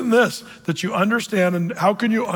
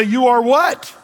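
louder, higher questioning "what?"; incredulous Moses, pitched up; baffled Moses; 4 semitones higher, 5 dB louder, slower than usual. Acted. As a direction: play Moses taken aback, voice raised. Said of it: "you can almost hear Moses say".

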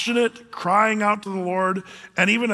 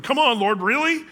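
higher, louder, incredulous whine; whiny complaint; 7 semitones higher, 3 dB louder than usual. Retold or imitated. Preacher mimicking believers whining at the Lord, pitched up. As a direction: moan at God in disbelief. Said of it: "Instead of just whining".

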